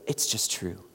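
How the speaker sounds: soft, low murmur of recognition; hushed realization. Retold or imitated. Congregant hearing good news, hushed low murmur. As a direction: let the truth land softly. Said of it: "don't you just go".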